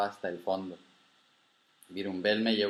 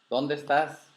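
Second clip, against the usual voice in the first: calling out; 4 semitones higher, slower than usual. Acted. Grandchild calls to unseen grandfather.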